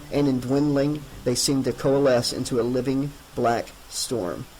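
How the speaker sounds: ordinary delivery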